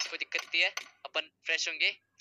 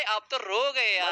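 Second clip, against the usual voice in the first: mocking taunt; 3 semitones higher, 4 dB louder, slower than usual. Real, not acted. Streamer taunts rival in quarrel.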